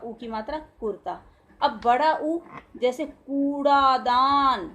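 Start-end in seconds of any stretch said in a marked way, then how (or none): 3.1-4.7 कूड़ादान said slowly with a held ऊ; slow louder कूड़ादान with a long ऊ; long ऊ demo. Shown as a demonstration. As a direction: stretch the कू of कूड़ादान. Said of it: "थोड़ा सा समय ज़्यादा लगा ना".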